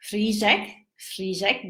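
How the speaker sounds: "Frižek" with fused r-zh, repeated separately; Czech word demo. Shown as a demonstration. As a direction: model "Frižek" carefully, end lifted each time. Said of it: "I would say".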